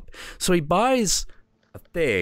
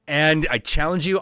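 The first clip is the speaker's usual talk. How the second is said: higher and a touch louder, and you challenge mean it; bolder challenge; 7 semitones higher, 3 dB louder than usual. Acted. Little Viking challenging the Northwind again, bolder and higher. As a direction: repeat the challenge with fresh nerve, pitched up.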